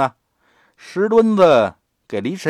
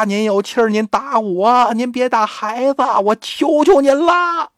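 又高又响地央求，最后一句拖长哭求; 一声比一声急，放慢求饶; 哀求着喊; 9 semitones higher, 4 dB louder than usual. Acted. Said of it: "孩子他爹也跟着喊". (孩子他爹在门外高声哀求别打孩子)

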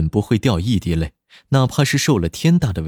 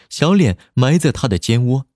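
ordinary delivery, nothing marked

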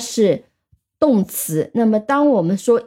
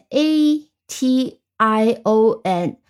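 slow letters with gaps between; spelling out; much slower than usual. Shown as a demonstration. Teacher shows the changed noun ending, spelling out slowly. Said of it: "变成".

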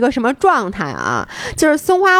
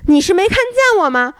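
retold shout; 8 semitones higher than usual. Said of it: "然后我就大喊".

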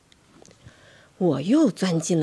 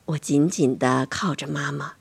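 ordinary delivery, nothing marked

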